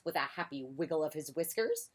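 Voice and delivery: usual speaking voice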